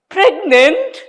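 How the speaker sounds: high, stunned rising "Pregnant?"; stunned high "Pregnant?" before fainting; shocked question. A narrator's reading. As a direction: gasp the word out in disbelief.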